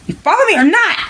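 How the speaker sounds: high-pitched impatient demand; impatient check. Real, not acted. Storyteller demanding whether the listeners follow, impatient. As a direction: press the listeners with a sudden high squeal. Checking audience after no response.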